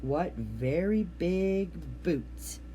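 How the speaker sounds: slow, awed frog voice; drawn-out croak of wonder. A narrator's reading. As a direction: frog peeping over at big boots, slow and awed. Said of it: "he croaked".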